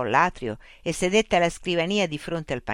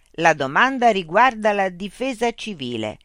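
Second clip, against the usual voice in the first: machine voice; 5 semitones higher, 6 dB louder than usual. Acted. Loudspeaker robot voice addressing Mitch. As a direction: speak as a polite, mechanical information unit.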